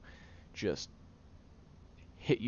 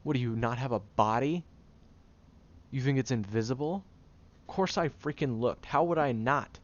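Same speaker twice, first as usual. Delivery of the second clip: loud and incredulous, pitched up; exasperated outburst; 4 semitones higher, 7 dB louder than usual. Acted. Caretaker admits to the angry friend that he looked, loud exasperation. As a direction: throw the obvious answer back at them. Exasperated over looking at body.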